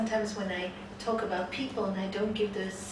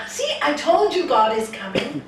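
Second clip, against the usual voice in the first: louder, higher, vindicated exclamation; excited triumph; 5 semitones higher, 10 dB louder than usual. Acted. Man telling his friends God is coming, excited. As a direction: crow it to the doubters.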